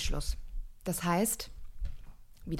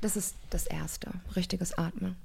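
whisper; 6 dB quieter than usual. Real, not acted. Coach stressing a rule.

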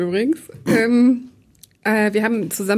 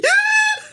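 shrieking laugh. Real, not acted. Laughs at the scare.

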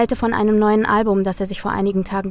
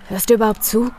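annoyed; faster than usual. Acted. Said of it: "mit einem genervten Ton".

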